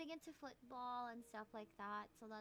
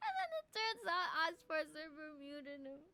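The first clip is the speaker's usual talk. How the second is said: high voice breaking up with laughter; laughing; 5 semitones higher, 6 dB louder than usual. Real, not acted. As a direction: tell the punchline through giggles. Laughing at her own anecdote.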